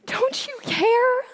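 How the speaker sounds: high, anguished crying out; tearful anguish to God; tragic heroine sorrow. Acted.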